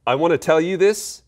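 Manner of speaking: "this" high and loud at the end; emphatic. Shown as a demonstration. Teacher translating "come here" for viewers, emphatic on "this". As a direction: hit it hard, pitched well up. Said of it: "put more emphasis on what I'm about to say".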